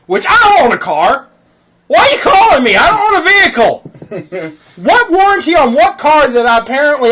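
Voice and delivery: indignant, almost shouting; exasperated yell. Retold or imitated. Annoyed at a car warranty scam caller, loud and high.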